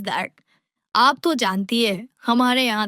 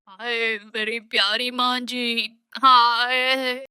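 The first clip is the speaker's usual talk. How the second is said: melodramatic mock wailing; slower than usual. Daughter-in-law putting on a show for mother-in-law, melodramatic mock wail. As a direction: wail "haaay" as an exaggerated act. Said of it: "जोर-जोर से नाटक करना शुरू कर देती है".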